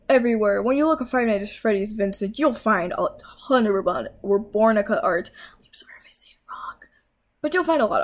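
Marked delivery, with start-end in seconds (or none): 5.6-6.8 sudden soft whisper of an apology; hushed aside, barely voiced. Real, not acted. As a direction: drop to a whisper, half to yourself. Apologising for a possible mispronunciation.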